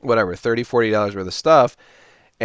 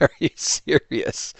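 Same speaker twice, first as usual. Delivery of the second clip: higher-pitched, laughing through the words; laughing incredulously; 5 semitones higher, slower than usual. Real, not acted. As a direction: crack up while retelling your disbelief. Laughs retelling the lowball offer.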